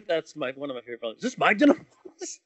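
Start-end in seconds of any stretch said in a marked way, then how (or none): 1.2-2.4 laughing through the quote. Retold or imitated. Repeating the quote while laughing.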